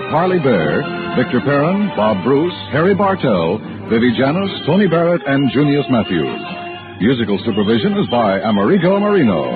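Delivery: usual speaking voice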